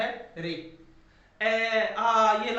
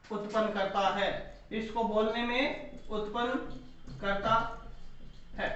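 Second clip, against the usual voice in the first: dictation; 2 semitones lower, 5 dB quieter, much slower than usual. Shown as a demonstration. Teacher dictating a rule for notes.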